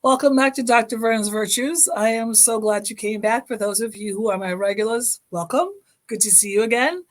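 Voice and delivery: ordinary talk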